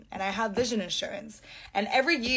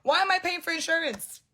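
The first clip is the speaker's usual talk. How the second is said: incredulous; 7 semitones higher than usual. Retold or imitated. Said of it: "I'm like".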